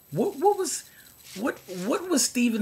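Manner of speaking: high incredulous what; exasperated squeak. Real, not acted. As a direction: voice jumps way up in disbelief. Exasperated rant about Seagal.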